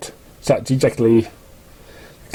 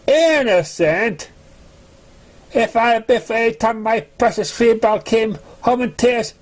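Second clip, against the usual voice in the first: higher-pitched, indignant pony parent, mildly voiced; offended parent; 8 semitones higher than usual. Parent's angry reply in story.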